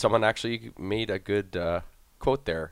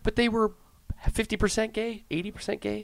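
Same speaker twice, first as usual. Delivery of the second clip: much higher, incredulous question; shocked; 12 semitones higher, 4 dB quieter than usual. Real, not acted. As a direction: gasp it out in disbelief. Shocked disbelief about the show.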